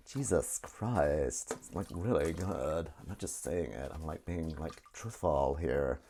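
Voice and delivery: slow, drawn-out valley girl put-on; low, dragging mock valley girl; valley girl accent. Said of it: "valley girl, isn't it".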